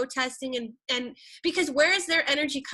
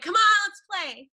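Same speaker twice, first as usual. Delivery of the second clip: higher and louder, coaxing; eager call to play; playful invite; 6 semitones higher, 6 dB louder than usual. Retold or imitated. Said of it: "I'm like".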